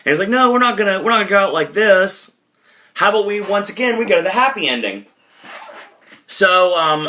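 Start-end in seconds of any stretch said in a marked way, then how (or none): 0.3-5.1 high-pitched eager refusal; put-on indignant pep, pitched up; mock protest. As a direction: imitate someone rejecting a bad ending. Acted refusal of the false ending.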